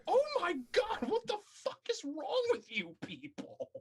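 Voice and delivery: high, exasperated "what is wrong with you"; exasperated outburst. Retold or imitated. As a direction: throw up your hands in disbelief.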